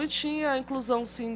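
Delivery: ordinary delivery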